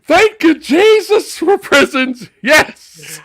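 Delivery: very high, loud mock hallelujah; hammed-up praise shout. Pryor relieved that prisons exist, shrieking mock praise. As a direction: shriek thanks to heaven.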